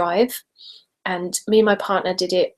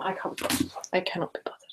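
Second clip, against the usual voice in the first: soft whisper, can't be bothered; whispered give-up; 11 dB quieter, much faster than usual. Real, not acted. Giving up on finding ringtone.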